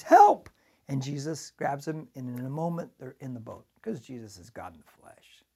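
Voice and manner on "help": sudden shouted cry for help, much higher and louder; scream. Retold or imitated. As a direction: yell it like a sinking man. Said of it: "And he screams".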